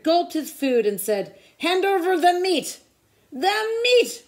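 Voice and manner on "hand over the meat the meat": high, loud roar; pirate roar. A narrator's reading. Pirates demand meat at dinner: loud roar. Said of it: "we all roared".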